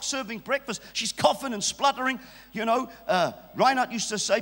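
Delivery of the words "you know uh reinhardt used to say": usual speaking voice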